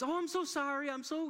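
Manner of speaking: a hint of a frantic seatmate; flustered wife. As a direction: imitate her frazzled apology. Flustered wife voiced by him.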